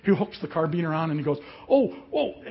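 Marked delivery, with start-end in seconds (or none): 1.7-2.4 high-pitched startled outcry; acted jolt of alarm; alarmed exclamation. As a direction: imitate a nervous rider caught off guard. Retold nervous friend being hooked on.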